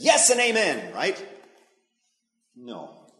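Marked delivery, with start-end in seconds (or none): 0.0-0.9 high, loud eager agreement; mock-eager Moses, high and loud; eager yes. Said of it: "Moses says".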